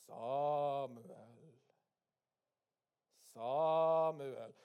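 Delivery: long, loud drawn-out call; voice calling Samuel. Acted. God calls the sleeping boy Samuel, long drawn-out calling. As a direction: call out the name slowly, stretching the vowels. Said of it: "Vet du hva han hørte?".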